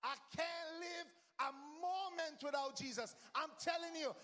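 ordinary talk, nothing marked

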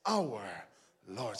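ordinary talk

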